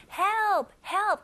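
mock shout for help, twice; loud call. Shown as a demonstration. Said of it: "큰 목소리로".

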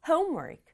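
homework with HOME stressed and work falling away; stress on first syllable. Shown as a demonstration. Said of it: "앞부분 강조해서".

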